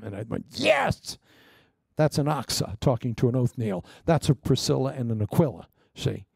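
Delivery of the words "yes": high cheering yes; excited yes. Retold or imitated. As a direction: cheer at the screen. Cheering at movie.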